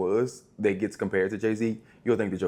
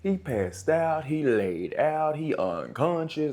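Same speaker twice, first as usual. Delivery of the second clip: slow dramatic; 6 semitones higher, slower than usual. Pretend.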